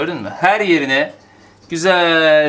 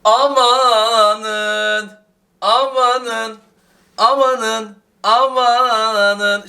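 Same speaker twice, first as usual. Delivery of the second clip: high, drawn-out cries of delight; amazed amanın; 9 semitones higher, 6 dB louder, much slower than usual. Real, not acted. Cook marvelling at her fresh baked bread, high and stretched. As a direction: stretch each amanın out in wonder.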